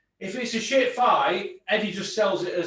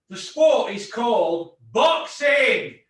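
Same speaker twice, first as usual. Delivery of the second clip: slow, loud, higher shout; emphatic shout; 5 semitones higher, 7 dB louder, slower than usual. Real, not acted. Ranting about the poor boxing card, slow loud shout. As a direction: hammer each word home.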